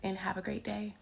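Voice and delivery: soft breathy whispered goodbye; papery whisper. Real, not acted. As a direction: whisper the farewell gently. Signs off in a whisper.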